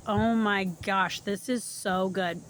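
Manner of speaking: ordinary talk, nothing marked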